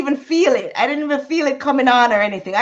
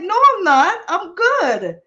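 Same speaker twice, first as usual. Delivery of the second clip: higher dazed denial; protest, higher; high stoned insistence; 8 semitones higher than usual. Retold or imitated.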